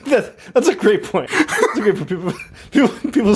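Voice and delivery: words bubbling out between laughs; laughing. Real, not acted. Cracking up at co-host's tomato point, laughing.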